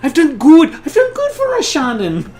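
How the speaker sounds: high, loud, hammed-up Irish pleading; Tom Cruise's Irish accent. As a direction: plead melodramatically in mock Irish.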